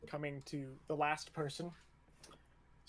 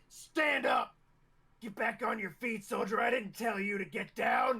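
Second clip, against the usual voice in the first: drill instructor shout; 5 semitones higher than usual. Acted. Instructor orders fallen recruit up, angry shout. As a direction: yell at a soldier to get moving.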